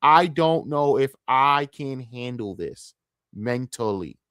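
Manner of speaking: very slow, worn-out delivery; exhausted, dragging slow; drained, drawn out. Real, not acted. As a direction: stretch every word like you're running on empty. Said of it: "I'm so mentally tapped of this shit".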